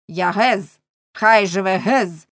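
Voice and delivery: loud, ringing rallying cry; battle cry. Acted. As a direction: proclaim it boldly, slowing on the last words. Draws sword, shouts rebel cry.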